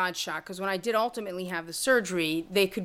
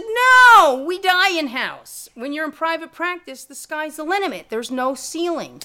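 higher, insistent persuasion; mock-passionate pitch, higher and louder; emphatic plea; 8 semitones higher, 9 dB louder than usual. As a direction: sell her on private practice. Pitching private practice to friend.

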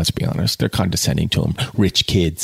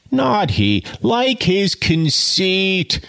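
screamed; 9 semitones higher, much slower than usual. A narrator's reading. Lady at the bar mocking Jude, mild high scream. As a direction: shriek it lightly, high. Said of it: "screamed one of the ladies".

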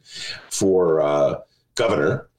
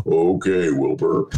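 low, drawn horse voice; Mister Ed voice; 10 semitones lower, slower than usual. Retold or imitated. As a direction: deepen into the talking horse. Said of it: "his health advisor will be Mister Ed".

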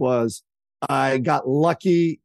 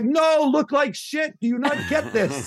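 much higher, slow shouted order, laughing at the end; mock shout; 12 semitones higher, slower than usual. PR boss ordering his client to look bad, hammed-up shout. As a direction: bellow at her like a frantic boss. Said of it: "He's like".

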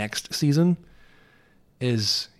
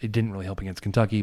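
ordinary talk, nothing marked

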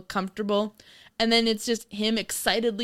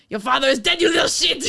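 high, loud hammed-up uncle; comic yell, about to crack up; exasperated mock shout; 5 semitones higher, 6 dB louder, faster than usual. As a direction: blurt it out like a fed-up uncle. Acts out exasperated uncle breaking news.

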